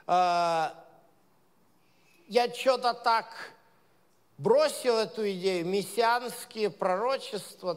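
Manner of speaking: ordinary delivery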